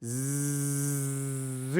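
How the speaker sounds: buzzing Z held on its own; isolated sound demo. Shown as a demonstration. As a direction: voice the sound Z by itself, zzz. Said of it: "let's look at zucchini".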